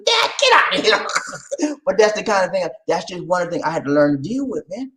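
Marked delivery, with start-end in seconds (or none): 0.1-1.1 very high mock protest; hammed-up exasperated outburst; acting out exasperation. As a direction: squeal it as a comic retort. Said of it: "I said".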